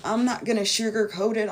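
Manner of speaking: ordinary delivery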